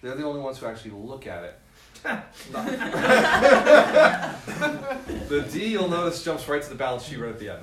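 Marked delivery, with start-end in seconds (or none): 1.6-5.2 loud high laugh; real big laugh. Real, not acted. Speaker with the audience, loud long laugh.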